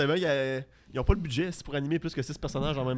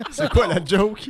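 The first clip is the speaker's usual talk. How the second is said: laughing; 5 semitones higher, 10 dB louder, much slower than usual. Real, not acted. Laughing during the joke.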